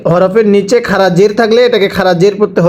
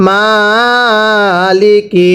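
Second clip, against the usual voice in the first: Maliki stretched very slowly; teacher models Maliki slowly; slow drawn-out reading; much slower than usual. Shown as a demonstration. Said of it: "সবাই পড়বেন".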